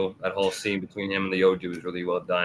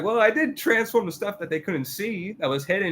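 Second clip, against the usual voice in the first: defensive excuse; 11 semitones higher than usual. Retold or imitated. Kafka defending himself.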